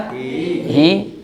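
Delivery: ordinary talk, nothing marked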